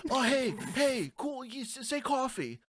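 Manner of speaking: high-pitched goading imitation; mocking classmates. Imitating classmates asking for accent words, high mocking voice. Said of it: "That was the normal exchange".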